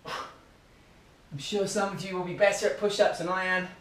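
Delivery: normal talking voice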